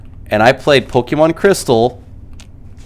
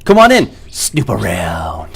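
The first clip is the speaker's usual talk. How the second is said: slow movie quote; 5 semitones lower, slower than usual. Retold or imitated. Quoted movie line, slow low.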